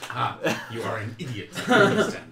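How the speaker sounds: talking through laughter; amused. Real, not acted. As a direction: tease a friend while laughing. Players laugh at the moon mistake.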